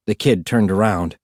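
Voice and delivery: usual speaking voice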